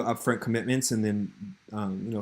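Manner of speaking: usual speaking voice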